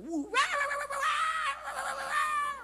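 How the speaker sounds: very high, loud shrieked babble; mock nagging babble. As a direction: shriek wah-wah like endless scolding. Mimics a wife's nonstop nagging. (tiruan omelan nyerocos melengking)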